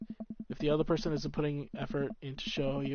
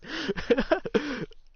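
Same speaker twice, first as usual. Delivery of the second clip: cracked high laugh; high breaking laugh; 14 semitones higher, 6 dB louder than usual. Real, not acted. Laughing at her own strained voice, cracked high laugh. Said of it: "sorry, God, this voice".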